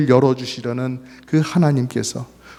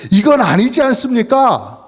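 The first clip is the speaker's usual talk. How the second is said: higher-pitched protesting question; indignant protest; 9 semitones higher, 4 dB louder than usual. Acted. People challenging God over humanity's misery, indignant protest.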